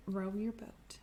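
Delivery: whispered line of the song. Acted. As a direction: drop to a whisper for the kids. Sudden hush within the song.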